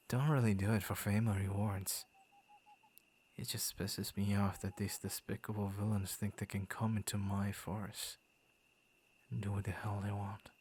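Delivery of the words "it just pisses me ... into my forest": usual speaking voice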